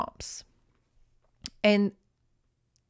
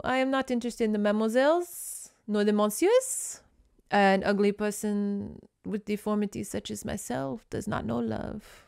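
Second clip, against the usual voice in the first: mock French accent; 3 dB quieter than usual. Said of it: "And Les Boisseux".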